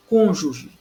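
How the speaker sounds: "Cônjuge", falling away after CÔN; stress on CÔN. Shown as a demonstration. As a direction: punch the first syllable of "Cônjuge".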